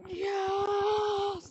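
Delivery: high loud cry of relief; triumphant yell. Real, not acted. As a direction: shout in victory. Escapes, relief.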